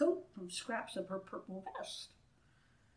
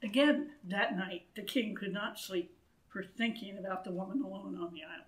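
usual speaking voice

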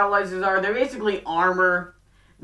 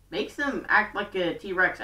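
normal talking voice

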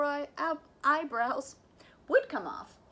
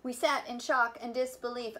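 plain reading voice

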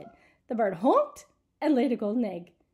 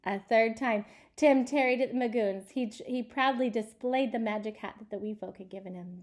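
plain reading voice

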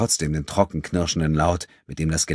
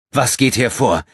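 higher and louder, snapping at him; barking demand; 6 semitones higher, 6 dB louder than usual. A narrator's reading. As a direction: demand an answer with force. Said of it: "herrschte er Abudun an".

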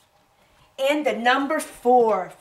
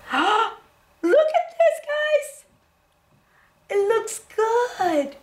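amazed gasp; 10 semitones higher, much slower than usual. Real, not acted. Gasps at dotted painting.

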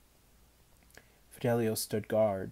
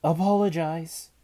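stern command; 7 semitones higher, 10 dB louder than usual. A narrator's reading. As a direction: demand it at full voice. Cook orders Manfred.

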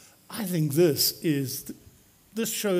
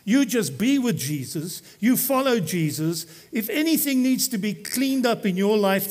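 ordinary talk

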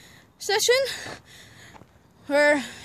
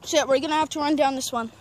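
ordinary talk

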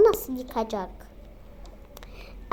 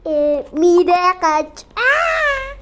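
put-on child voice; 3 semitones higher, 8 dB louder, much slower than usual. Child voice then cry.

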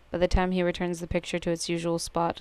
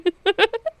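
high giggle; 6 dB louder than usual. Real, not acted. Laughing at the game.

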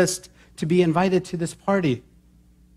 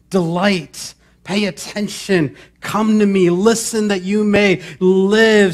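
building call; 5 semitones higher, 4 dB louder, slower than usual. Acted.

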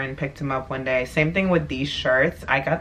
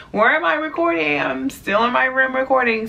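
amused surprise; 9 semitones higher than usual. Real, not acted. Laughing at her own slip.

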